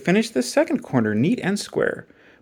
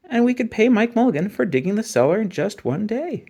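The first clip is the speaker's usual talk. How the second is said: put-on high, eager child's idea; boy proposing the furnace plan, high and eager; little boy voice; 6 semitones higher than usual. A narrator's reading. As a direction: pitch the idea like a bright small boy. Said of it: "He said".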